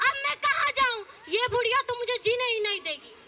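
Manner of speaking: ordinary delivery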